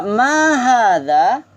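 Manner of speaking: slow, drawn-out Arabic drill phrase; slow model for repeating. Shown as a demonstration. Teacher models the phrase slowly for students to repeat. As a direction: spell out each syllable for the class to echo.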